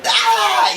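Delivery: high loud mock scream of rage; husband screaming at wife's betrayal; anguished yell. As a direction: let out a furious cry. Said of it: "And I was like".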